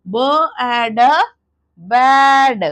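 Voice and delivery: ब एड then बैड, loud on बैड; phonics blending. Shown as a demonstration. Teacher showing the right way to read, loud blend. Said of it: "sounding से पढ़ेगा".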